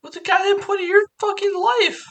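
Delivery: very high, indignant comeback; mock-angry retort. Cohost firing back at the host, mock indignation. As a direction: throw it back in shrieky fake outrage.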